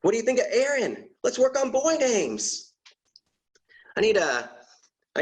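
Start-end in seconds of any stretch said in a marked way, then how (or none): 0.0-2.7 eager wife. Retold or imitated. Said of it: "the woman's like".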